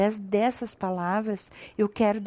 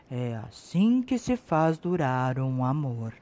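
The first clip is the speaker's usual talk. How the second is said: tender mother; 8 semitones lower, 7 dB quieter than usual. Acted. Mother's closing lesson.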